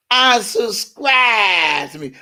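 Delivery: high, slow, mocking woman's voice; high, slow mock of Boom Boom. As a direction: drag it out like the gossiping rival. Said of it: "Boom Boom talking like this".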